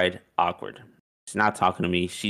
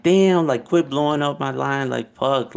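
snapping; 6 semitones higher than usual. Retold or imitated. Snapping at the girl who keeps texting, retold angry burst. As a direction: bark it at a clingy ex. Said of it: "then I think I snapped on her".

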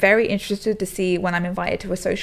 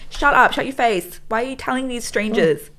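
mock scolding; 4 semitones higher, 3 dB louder than usual. Wife retells scolding husband.

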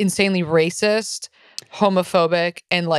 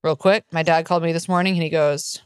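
ordinary talk

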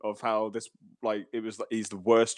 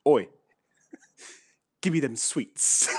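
chav voice; slower than usual. Retold or imitated. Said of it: "And he goes".

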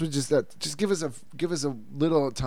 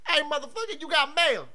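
much higher, softer cartoon voice; squeaky mock voice; 4 dB quieter than usual. Parody in squeaky put-on voice.

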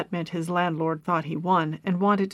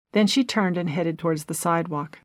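ordinary delivery

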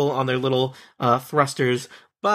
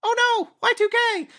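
high, squeaky little robot. Acted. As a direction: squeak it out like a tiny panicking robot. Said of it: "says in a squeaky little robot voice".

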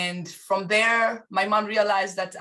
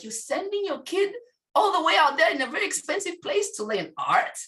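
much higher, incredulous relatives; relatives, higher and laughing in disbelief; mock disbelieving relatives; 9 semitones higher than usual. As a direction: squeak it like shocked aunties.

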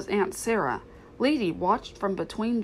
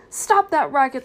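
loud, higher shouted order; mock scolding; 6 semitones higher, 8 dB louder than usual. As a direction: yell like an irritated owner, mimicking. Tramp mimics scolding humans.

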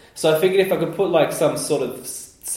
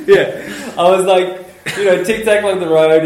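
words through laughter, higher and louder; laughing; 6 semitones higher, 10 dB louder than usual. Real, not acted. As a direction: talk while still cracking up. Still laughing after story.

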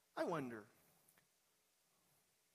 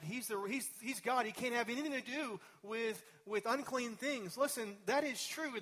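rising, higher and louder sermon climb; full-force preaching; 7 semitones higher, 4 dB louder than usual. Acted. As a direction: preach it with growing fervour.

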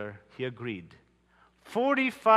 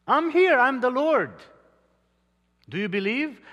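a voice from heaven, higher and louder; voice from above; 8 semitones higher, 6 dB louder than usual. Acted. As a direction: call down as the Lord. God answers the man.

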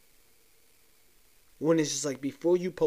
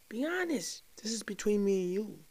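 coaxing tease; 8 semitones higher than usual. Pretend. Coaxing confession from viewers.

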